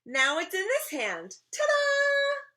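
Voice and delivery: high, loud showman flourish; big sing-out ending on the ta-da; triumphant reveal. Acted. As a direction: crow it like a kid magician. Child completes magic trick.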